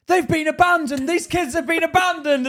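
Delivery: loud, very high-pitched outcry; mock police alarm. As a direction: cry out like an appalled officer. Police alarmed at finding children alone.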